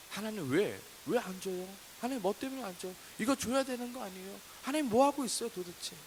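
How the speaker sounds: acted demanding prayer, climbing in pitch; nagging complaint. Believer questioning God for not giving, resentful. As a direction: pester God with rising impatience. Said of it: "따지듯 원망하듯".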